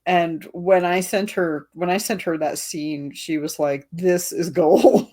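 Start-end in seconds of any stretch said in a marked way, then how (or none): 3.9-5.1 slow, drawn-out mock raving; retold delighted verdict. Author quoting a friend's reaction to the scene, drawn-out delight. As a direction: stretch each word out, imitating an impressed friend.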